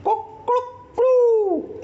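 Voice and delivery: high, stretched cock-a-doodle call; rooster crow. Shown as a demonstration. Teacher imitating a rooster's crow for students. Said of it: "जैसे मुर्गे की बांग होती है".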